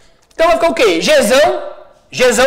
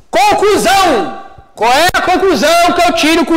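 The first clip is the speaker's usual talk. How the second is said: emphatic announcement; 5 semitones higher than usual. Real, not acted. Teacher announces conclusion to class.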